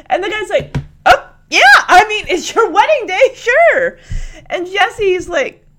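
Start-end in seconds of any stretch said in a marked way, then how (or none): 1.1-4.0 loud high agreement; eager driver. Retold or imitated.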